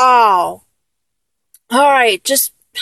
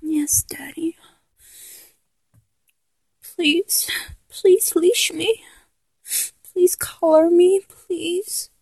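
breathy, meek whisper, much softer; submissive whisper; 3 semitones higher, 6 dB quieter, slower than usual. Acted. Giving in to the partner in a submissive whisper. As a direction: whisper it like a shy surrender. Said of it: "I gotta do the voice".

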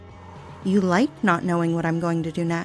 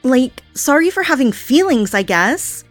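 louder and louder, aggrieved and sarcastic; rising frustrated rant; 5 dB louder than usual. Acted. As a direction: spiral up into a bitter, hurt outburst. Yandere rants at tied captive.

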